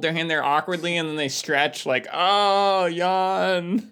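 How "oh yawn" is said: faking tiredness. Pretend. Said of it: "a really stupidly fake yawn".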